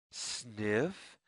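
usual speaking voice

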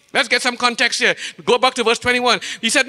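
usual speaking voice

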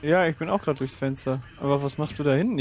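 ordinary talk, nothing marked

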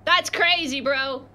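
ordinary talk

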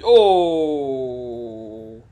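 loud, drawn-out falling "oh"; long groaning cry. Real, not acted. Player reacting to a crash, long loud groan. Cry as the game goes wrong.

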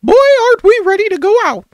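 high squeaky cartoon mouse voice; chirpy cartoon mouse, way up high; Mickey Mouse. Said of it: "practicing my Mickey impression".

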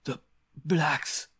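high, halting gasp; choked, breathless utterance. A narrator's reading. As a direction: struggle to get the words out after being choked. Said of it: "he gasped".

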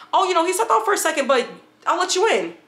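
quick, high mock of the cousin brushing it off; fast and breezy, covering for him; casual cousin.

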